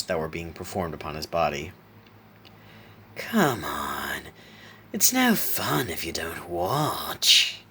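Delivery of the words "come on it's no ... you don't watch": slow, swooping villain voice; playful fiendish clown. A narrator's reading. Clown coaxes Isaac to watch, sinister playfulness. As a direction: coax him slowly, toying with the victim. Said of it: "Laughing Jack said playfully".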